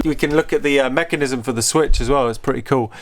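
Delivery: ordinary delivery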